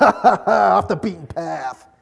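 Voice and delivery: drawn-out laugh breaking into the words; mock gleeful laugh. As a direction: laugh wickedly in play, slowly. Mock villain glee over stepped-on ants.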